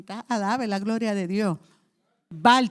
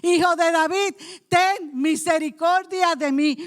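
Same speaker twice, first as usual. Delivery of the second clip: louder, higher spoken cry; calling out for mercy; 5 semitones higher, 4 dB louder than usual. Retold or imitated. Bartimaeus calling out to Jesus for mercy, louder and higher. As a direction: call it out to the passing Jesus. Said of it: "él comenzó diciendo".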